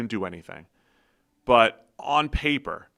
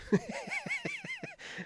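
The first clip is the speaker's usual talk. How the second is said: high laugh; 10 dB quieter than usual. Real, not acted. Laughs at own idiocy.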